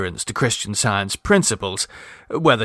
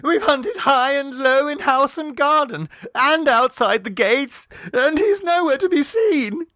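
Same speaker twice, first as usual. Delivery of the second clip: put-on high, fretful young mother's voice; anxious mother. A narrator's reading. Mrs Mummerby explains to Clovis, high and fretful. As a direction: keep the high worried mother going.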